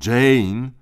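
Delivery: big brother cutting his sister off; scolding. A narrator's reading. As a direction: snap her name to scold her. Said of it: "zganił ją Tim".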